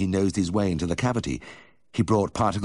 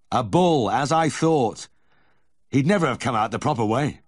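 raised, loud and emphatic; excited exclamation; 7 semitones higher, 6 dB louder than usual. A narrator's reading. Vet telling farmer about the bull calf, excited exclamation. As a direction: declare it loudly, pitched well up.

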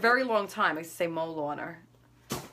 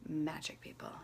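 whispered, much softer; hushed magic; 5 semitones lower, 11 dB quieter than usual. Real, not acted. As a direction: breathe it like a secret. Selling the product.

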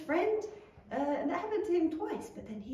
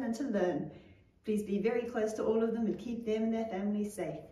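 prayer; 4 semitones lower than usual. Real, not acted. Leads a prayer.

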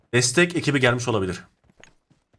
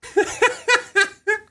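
high burst of laughter; 3 dB louder than usual. Real, not acted. Laughing at the enemy.

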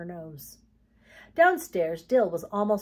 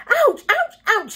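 pained yelps; 12 dB louder, slower than usual. A narrator's reading. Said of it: "he muttered".

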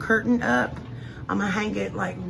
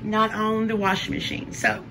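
normal talking voice

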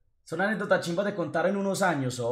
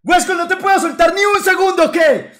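much higher, louder mock tirade; angry friend; 11 semitones higher, 11 dB louder than usual. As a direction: imitate Carlos scolding in outrage. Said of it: "se enojó hasta la chimba".

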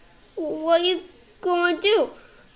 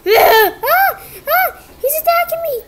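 much higher, loud cries of being attacked; panicked screams; 12 semitones higher, 5 dB louder than usual. Acted. Character attacked by the aggressive creature, screaming in panic. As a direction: scream as the creature jumps you.

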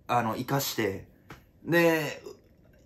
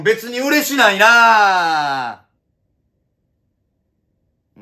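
very slow, loud, higher drawn-out yell; drawn-out shout; 7 semitones higher, 11 dB louder, much slower than usual. Acted. Comedian hamming up his denial as a long yell. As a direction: stretch it out like the catchphrase. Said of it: "repeated plainly after".

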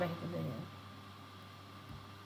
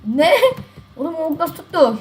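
laughing; 7 semitones higher, 7 dB louder than usual. Real, not acted. Laughs at lucky hit.

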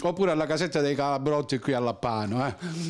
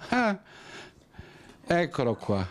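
usual speaking voice

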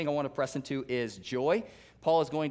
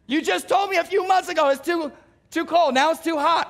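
much higher and louder, incredulous; exasperated retort; 12 semitones higher, 7 dB louder than usual. Retold or imitated. Pastor answering the complainer, exasperated high retort. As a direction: throw their own words back at them. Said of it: "You're like".

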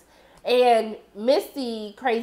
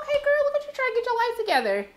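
teasing praise; 11 semitones higher, faster than usual. Retold or imitated. Teasing Natalie's recovery.

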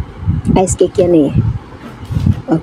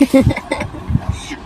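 trying not to laugh; 4 semitones higher, 7 dB quieter than usual. Real, not acted. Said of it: "Panay ka kasi tawa".

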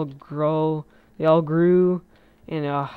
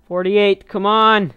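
higher, loud call; loud, raised-pitch exclamation; urging shout; 5 semitones higher, 11 dB louder, slower than usual. Real, not acted. Calls count, urging for more.

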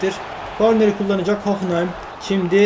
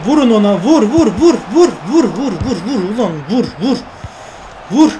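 frantic yelling; 4 dB louder than usual. Real, not acted. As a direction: scream at the screen to shoot. Urging players to shoot.

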